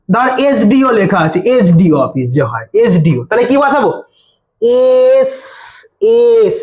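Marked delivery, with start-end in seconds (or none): 4.6-6.6 "এস" said slowly, opening on E; drawn-out E vowel. Shown as a demonstration. Teacher showing S begins with a vowel sound, "এস" drawn out. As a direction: stretch "এস" so its first vowel stands out. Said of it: "উচ্চারণটা এ হাসছে".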